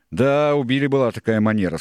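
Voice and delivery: loud, high, lively pirate reply; emphatic character voice; Israel agreeing. A narrator's reading.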